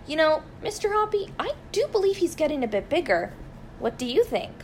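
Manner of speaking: Missus Silver, curious. A narrator's reading.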